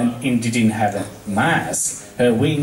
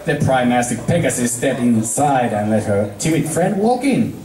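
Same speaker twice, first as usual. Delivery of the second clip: ordinary delivery, nothing marked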